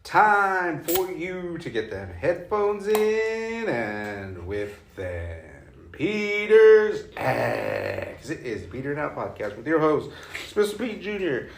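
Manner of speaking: slow hyped announcer voice. Acted. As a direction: stretch the intro out like a hype man. Dramatic hosts introduction.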